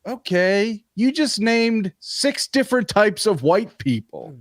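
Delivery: wanted to say it but never did, so mock disbelief; exasperated retort. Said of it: "And I just wanted to be like".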